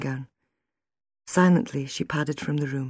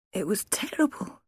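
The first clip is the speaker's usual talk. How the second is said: higher, lightly hoarse voice of distress; 5 semitones higher, 4 dB quieter than usual. A narrator's reading. Wife telling husband about her nightmare, hoarse. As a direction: say it small and hoarsely. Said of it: "she said hoarsely".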